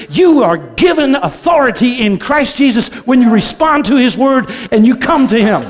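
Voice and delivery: loud, rising prophetic declaration; preaching at full force. Acted. Preacher declares the Lord's word to the congregation, loud and fervent. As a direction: proclaim it over the church.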